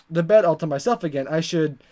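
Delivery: normal talking voice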